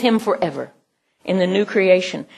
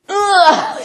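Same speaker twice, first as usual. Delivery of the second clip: straining grunt; 11 semitones higher, 4 dB louder than usual. Shown as a demonstration. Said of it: "They go".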